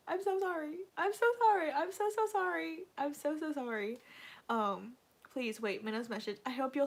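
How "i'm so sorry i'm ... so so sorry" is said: high-pitched, whiny pleading; distressed apology. Real, not acted. Fan apologising to viewers for a painful memory, whining.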